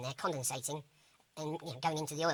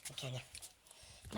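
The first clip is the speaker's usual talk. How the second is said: breathy whisper, very slow; whispered aside; 4 semitones lower, 11 dB quieter, much slower than usual. Real, not acted. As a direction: whisper it under your breath.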